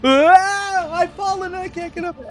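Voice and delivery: high, falsetto cry for help; old lady ad parody. Pretend. Retiree mocking the fallen elderly ad, in high falsetto. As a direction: squeal it like the old medical alert ad.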